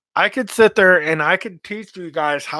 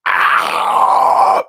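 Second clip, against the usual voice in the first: much lower, louder raspy scream; baritone scream; 11 semitones lower, 6 dB louder than usual. Shown as a demonstration. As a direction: dig a mid-range scream from the belly. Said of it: "your average mid-range baritone scream".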